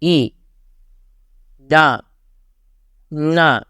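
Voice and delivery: "I. Da. Na." each dropping sharply; falling fourth tone. Shown as a demonstration. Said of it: "From the fourth tone".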